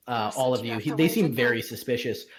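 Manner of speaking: ordinary talk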